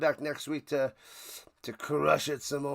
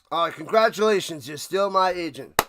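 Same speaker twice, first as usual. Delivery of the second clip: usual speaking voice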